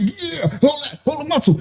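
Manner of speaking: put-on pumping up a little kid; hyping up. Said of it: "I'm like".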